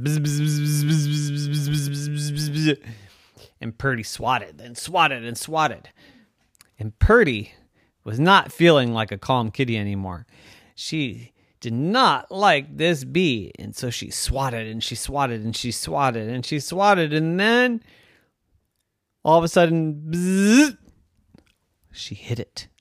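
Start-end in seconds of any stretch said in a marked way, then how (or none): 0.0-2.9 steady raised bee buzz. Acted. Bee buzzing around the cat's head, buzzing. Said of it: "And the bee went". 20.1-20.8 high, loud bee buzz; a sudden angry buzz. Acted. Bee buzzing right before the hit, loud buzz. Bee buzzes as cat swats.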